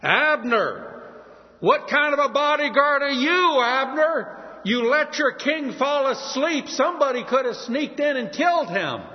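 mock the failed bodyguard from afar; David taunting Abner, a mocking yell; calling out mockingly. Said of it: "he calls out to Saul's right-hand man".

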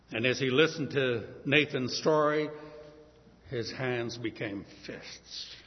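normal talking voice

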